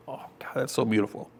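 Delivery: moved aside. Real, not acted.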